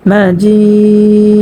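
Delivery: মাজিদে with the long vowel held for three counts; madd arid. Shown as a demonstration. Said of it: "তিন আলিফ পরিমাণ টানিয়ে পড়তে হবে". (মাজিদে তিন আলিফ টেনে)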